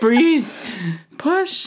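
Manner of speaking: hammed-up birth coach; mock midwife. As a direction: coach a birth in a rising squeaky voice. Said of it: "Miranda sings".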